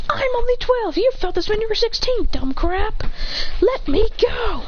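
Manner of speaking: high-pitched pleading complaint; mock distressed protest. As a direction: protest high, stretching the final plea. Acted protest while being held up.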